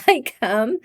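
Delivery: usual speaking voice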